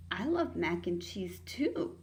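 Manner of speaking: ordinary delivery, nothing marked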